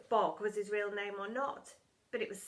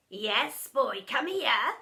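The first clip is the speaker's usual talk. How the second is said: slow, louder sinister call; menacing teacher; 8 dB louder, much slower than usual. A narrator's reading. Doctor Dredd summoning a pupil, slow menacing call. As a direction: beckon the boy with relish. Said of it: "he would say".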